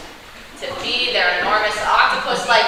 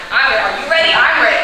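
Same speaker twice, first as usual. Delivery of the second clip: getting louder each time; excited; 6 dB louder than usual. Acted. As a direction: build to a giddy yell. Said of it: "I'm so excited".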